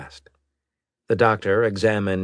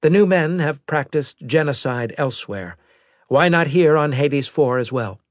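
higher-pitched, louder officer's voice; intelligence officer; 9 semitones higher, 6 dB louder than usual. Acted. Officer briefing the admiral on a survivor, higher-pitched report voice. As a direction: deliver a formal report in a raised pitch.

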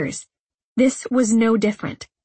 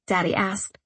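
usual speaking voice

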